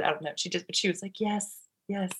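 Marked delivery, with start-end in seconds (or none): 1.2-2.1 quiet consent. Retold or imitated. Birth mother's quiet consent, retold.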